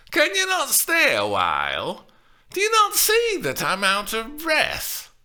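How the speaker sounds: put-on high, loud put-upon old woman; the nurse scolding impatient Juliet; breathless old nurse. A narrator's reading. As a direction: complain you're out of breath.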